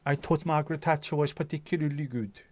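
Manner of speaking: lightly put-on Swedish accent; a hint of a Swedish voice for the footballer; Swedish-sounding accent. Quoting a Tory footballer in a light Swedish accent. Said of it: "Why are you sounding Swedish?".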